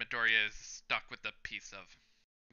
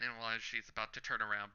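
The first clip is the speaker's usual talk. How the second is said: ordinary talk, nothing marked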